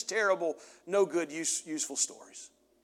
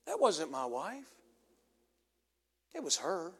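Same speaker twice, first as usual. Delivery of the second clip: hushed inner voice; 6 dB quieter than usual. Retold or imitated. God's inner voice speaking.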